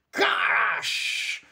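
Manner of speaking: breathy crash sound effect; breathy crash as the window breaks. A narrator's reading. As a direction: hiss out a sharp crash.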